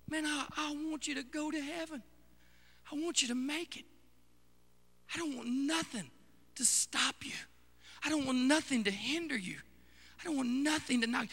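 softly begging the church to make heaven; tender plea. Real, not acted. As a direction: plead gently, heart to heart. Soft pleading with congregation.

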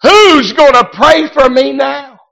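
high, loud, tearful wail; a lost son crying out over his mother's grave; crying out to God. Retold or imitated. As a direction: cry out to God in despair. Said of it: "I cried out".

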